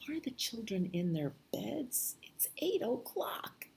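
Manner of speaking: high, soft, whispery little-man voice; little night watchman. Acted. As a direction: call it softly and high, as if at a keyhole. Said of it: "And he says".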